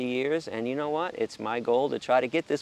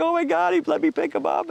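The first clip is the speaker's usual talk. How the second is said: high, loud burst of amazement; shocked delight; 7 dB louder than usual. Retold or imitated. Man stunned a chicken let him pick it up, shocked squeal. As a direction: exclaim it high and loud, astonished. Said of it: "I was shocked".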